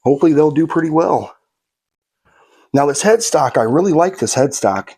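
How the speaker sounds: ordinary delivery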